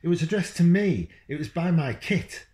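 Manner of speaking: dad snaps back, stung; indignant dad. Acted. Dad retorting in argument.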